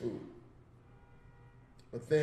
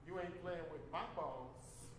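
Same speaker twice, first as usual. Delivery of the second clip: quiet punchline; 14 dB quieter, slower than usual. Shown as a demonstration. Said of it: "That was the punchline".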